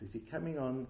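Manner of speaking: ordinary talk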